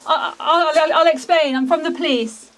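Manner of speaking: loud, high call through a door; apologizing through a door. Real, not acted. Calling through door to old man.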